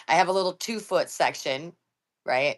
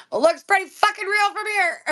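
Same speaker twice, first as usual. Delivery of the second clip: much higher, loud mock retort; mock shrill grandma; 5 dB louder than usual. Grandma answers grandson that it looks real, high mock retort. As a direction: squeal it like the horrified grandma.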